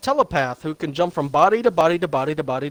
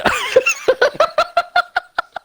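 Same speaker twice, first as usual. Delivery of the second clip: loud high laugh; 5 dB louder than usual. Real, not acted. Laughing at own impression.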